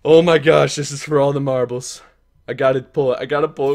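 ordinary talk, nothing marked

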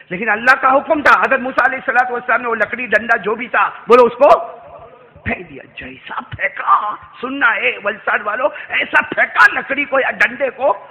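loud, rousing sermon storytelling; booming narration building suspense; full-force preaching. Acted. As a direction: preach it loudly to the crowd.

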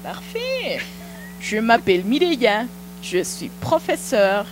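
ordinary delivery

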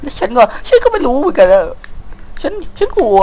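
put-on high falsetto scared little girl; mock scared girl. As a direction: squeak it out as the frightened daughter. Dubbing scared daughter in cutscene.

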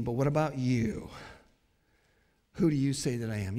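low, soft and searching; quiet personal question. Acted. Jesus asks his disciples personally, quiet and low. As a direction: put it softly and directly to each listener.

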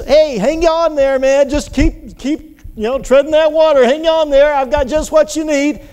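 high-pitched call across the water. Acted. Voices would-be rescuer calling to swimmer.

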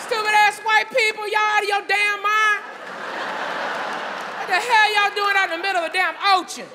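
high-pitched, incredulous outburst; heckling moviegoer. Retold or imitated. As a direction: heckle the screen in comic disbelief. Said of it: "the brother started first. He was like".

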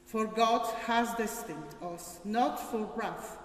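ordinary delivery

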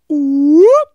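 quick rising high whoop; siren whoop. Shown as a demonstration. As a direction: flick up like a siren. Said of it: "make our I move faster".

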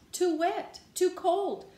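high, quick-fire objections; fussy complaining. Acted. Pigeon fussing over the bathwater, high fussy complaint.

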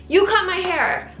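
high, slow indignant retort. Retold or imitated. Client refusing hairdresser's offer, indignant.

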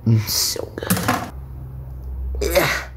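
slow breathy whisper of delight; hushed, slowed-down mmm of pleasure; whispered savouring. Pretend. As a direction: whisper it like a secret treat. Alone, savouring the fruit.

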